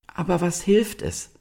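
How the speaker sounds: high-pitched, reasoning fawn; sensible young deer. A narrator's reading. Young deer talks down their fear, sensible. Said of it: "sagte die vernünftige Ulla".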